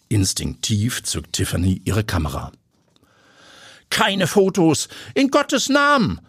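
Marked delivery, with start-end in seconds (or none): 3.9-6.2 very high, desperate protest; high-pitched alarmed plea; frantic objection. Acted. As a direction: forbid it in panic, pitched way up. Landowner pleads against photos.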